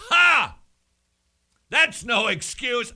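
high, loud "Ha!", then high dismissal; mock scoff. Host brushing off a listener's work excuse, mock scoffing. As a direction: scoff theatrically at a feeble excuse.

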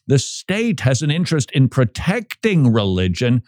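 ordinary delivery

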